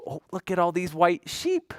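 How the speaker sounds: ordinary talk, nothing marked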